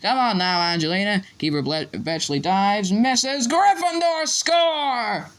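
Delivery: commentary climbing to a high, drawn-out cry; excited match commentary. Acted. Commentator calling the goal to the crowd, excited. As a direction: build up to a long, high goal call.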